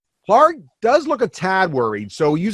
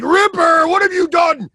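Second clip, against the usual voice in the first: anguished master's shout; 11 semitones higher than usual. Acted. Game master as Clark seeing his wolf fall, dismayed shout. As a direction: cry out in high-pitched dismay. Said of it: "and Clark's like".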